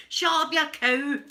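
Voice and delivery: loud, high, drawn-out telling-off; hammed-up comic yell of exasperation; mock-angry scolding. Woman tells Alexa to shut up, mock-angry. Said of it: "I say to her".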